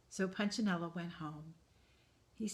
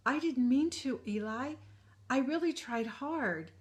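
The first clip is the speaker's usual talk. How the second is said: put-on pleading small boy; 7 semitones higher, 6 dB louder than usual.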